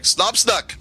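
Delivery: high-pitched goblin command; high goblin voice, slowing on the announcement; goblin calling out. Acted. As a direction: call out from inside the fort in a high voice. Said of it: "You hear a voice coming from inside".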